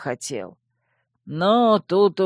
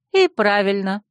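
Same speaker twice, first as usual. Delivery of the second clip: firm, louder approval; approving; 4 semitones higher, 5 dB louder than usual. A narrator's reading. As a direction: say it like she fully agrees. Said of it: "одобрила я".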